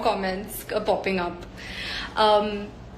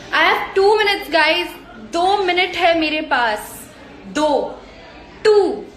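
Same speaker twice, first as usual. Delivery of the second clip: excited announcement; 6 semitones higher, faster than usual. Real, not acted. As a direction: announce it brightly so everyone hears. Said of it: "announcing time left to fans".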